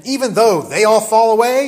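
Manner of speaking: loud, high-pitched, presumptuous boast; high and mighty. Acted. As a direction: proclaim it loudly, setting yourself above the others. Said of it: "very kind of high and mighty".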